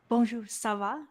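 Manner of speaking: French greeting in a learner's French accent. Shown as a demonstration. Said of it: "Rate my French from one to ten".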